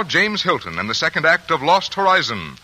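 ordinary talk

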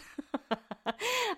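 soft, high-pitched laugh. Real, not acted. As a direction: laugh lightly at your own tweet. Laughs after reading her own tweet.

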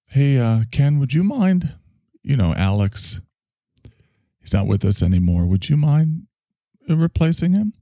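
high, polite asking; lightly voiced coaxing request; a hint of a nervous producer; mock producer. Acting producer asking Ken.

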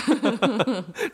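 real laughing outburst; high laugh. Real, not acted. Laughs at story.

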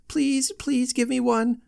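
put-on high, insistent child stressing please; child begging. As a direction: keep pleading, leaning on please. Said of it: "That was the cry".

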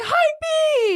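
very high excited yell; hyped shout. Retold or imitated. Commenters' cry quoted.

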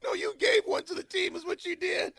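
high, loud laughing retort. Real, not acted. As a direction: laugh through the comeback. Laughing at the fart joke.

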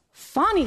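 Fani said slow and high, stressing the first syllable; "Fani" drawn out as a deliberate demo; correct Fani. Shown as a demonstration. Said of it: "I care about pronunciation".

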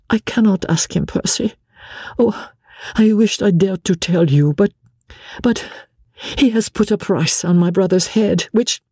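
a distressed wife begging her husband; Marguerite pleading. A narrator's reading.

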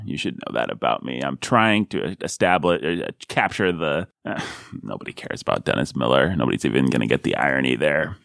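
deadpan it like a smug late-night comic; Dennis Miller impression. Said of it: "I'm basically just doing Dennis Miller".

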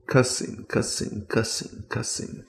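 hushed, fading to a whisper; mock cussing. Imitated cussing after the golf shot.

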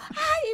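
high yelp edged with laughter; very high, laughing "¡ay!"; retold scream back. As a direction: imitate your own startled shriek. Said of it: "y yo grito".

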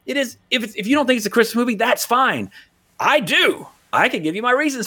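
high, heated rant at the doubters; worked-up rant. Real, not acted. Defending Die Hard to the doubters, heated rant. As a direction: get riled up defending the movie.

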